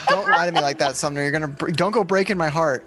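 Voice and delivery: ordinary talk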